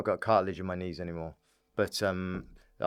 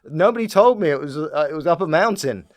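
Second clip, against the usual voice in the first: loud, high-pitched indignant wail; exaggerated loud complaint, raised pitch; mock protest; 5 semitones higher, 10 dB louder than usual. Teasing a friend about his climb, mock loud protest. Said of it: "And you're like".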